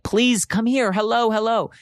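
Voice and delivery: high call for help to passing cars. Retold or imitated. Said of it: "waving them down".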